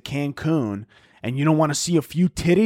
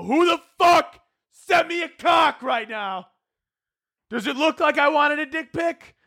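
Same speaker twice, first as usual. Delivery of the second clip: very high, drawn-out outraged yell; hammed-up screaming demand, pitched way up; mock enraged shout; 13 semitones higher, slower than usual. Woman stopping her car over a picture, mock enraged shout.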